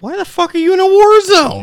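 loud, high-pitched incredulous yell; incredulous outburst. Acted. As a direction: blurt it out in mock disbelief, way up high. Hypothetical incredulous reaction to friend's call.

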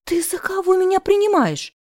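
high, offended squeal of a question; acted high-voiced indignation; indignant. A narrator's reading. Heroine offended by indecent offer.

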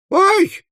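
very high sudden yelp; high-pitched cry of pain; startled squeal. Acted. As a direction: squeal 'ow' as if jabbed. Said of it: "взвизгнул мистер Пиквик".

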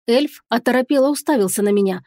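plain reading voice

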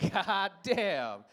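normal talking voice

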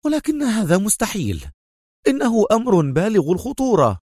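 high, indignant protest; exasperated cry. A narrator's reading. Consul objects to the dangerous plan, exasperated. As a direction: cry out in exasperation, pitched right up. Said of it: "وهو يهتف في حنق".

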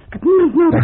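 loud, high defiant outburst; shouting him away; angry shout. Acted. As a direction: snap it back at him, furious. Woman rejects the helper angrily.

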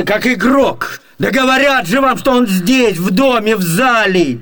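ordinary delivery

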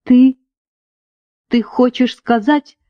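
halting, with a long break after the first word; understated, stunned stammer; faltering babble. A narrator's reading. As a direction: falter as if barely able to ask. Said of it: "пролепетала Эвис".

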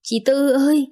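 a sister crying out at night for help; crying call. Acted. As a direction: call out through tears at the gate. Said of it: "tiếng khóc gọi của dì Sáu".

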